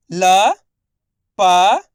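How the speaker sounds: लटक pulled apart letter by letter, loud; very slow sounding out. Shown as a demonstration. Teacher sounds out लटक very slowly, loud. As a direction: drag each letter of लटक. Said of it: "मैं किस तरीके से एक एक अक्षर".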